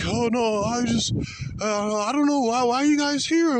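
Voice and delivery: high, whiny mock woman's voice. Mocking the woman as police arrive, high whiny voice. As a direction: mimic her playing dumb in a squeaky voice. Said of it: "she's like".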